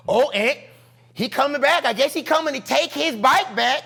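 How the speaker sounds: put-on high, excited kids egging on a fight; neighborhood kids. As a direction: imitate children stirring up trouble. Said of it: "And so they go".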